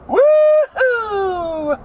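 high excited woo-hoo; whoop. Real, not acted. Driver cheering in the car, high whoop. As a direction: let out a happy whoop.

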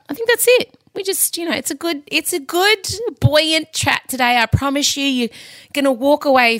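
bubbly high-pitched promise; excited. Real, not acted. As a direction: lift it up, full of glee. Promising a buoyant episode.

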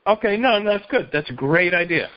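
normal talking voice

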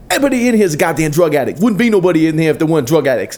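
fast, high, heated imitation; high-pitched tirade as an irate man; mock angry rant. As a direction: rant at the whole room in a rush. Retold ranting man in quarrel.

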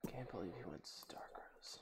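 breathy whispered try; whispered rehearsal of the sentence. Shown as a demonstration. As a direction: whisper the line to yourself. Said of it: "think of it in the accent".

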